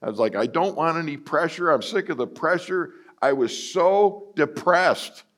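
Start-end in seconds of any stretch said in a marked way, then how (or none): 0.4-2.9 much higher exasperated plea; retold weary complaint; fed-up whine. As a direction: whine like a worn-out pastor.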